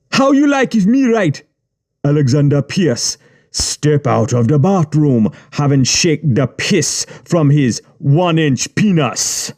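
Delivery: mock his prose, then scold hard; mocking gang boss. A narrator's reading. Yubi ridiculing the boy's writing, high and sneering.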